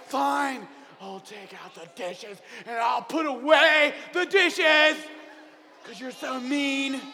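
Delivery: giving in while sulking loudly; grudging kid. Acted. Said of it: "And so you're like".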